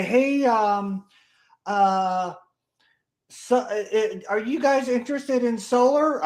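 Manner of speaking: high, hesitant, halting mock sales pitch, slowed down; timid salesman. Mocking the meek pitch he never used: a high, slow, hesitant rookie. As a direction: play a nervous rookie asking meekly.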